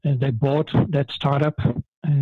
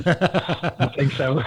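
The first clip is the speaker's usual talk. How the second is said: laughing; much slower than usual. Real, not acted. Shared joke about Dutch accents.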